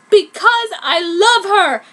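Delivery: loud, slow declaration; passionate. A narrator's reading. Kishu declares love to Pai, loud and slow. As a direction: declare it with force. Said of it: "said Kishu".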